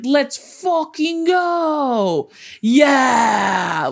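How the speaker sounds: drawn-out, high-pitched sports-fan cheer; mock fan scream. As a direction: imitate him cheering at the game, dragging it out. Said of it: "like, screams. Like, he's like".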